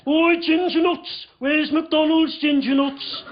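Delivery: slow, drawn-out Birmingham heckle; Birmingham kids. Retold or imitated. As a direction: call out slowly in a Birmingham accent. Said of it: "some kids pulled over".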